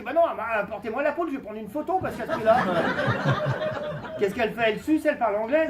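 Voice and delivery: hammed-up indignation at the price; indignant retort. Retelling his reply to saleswoman.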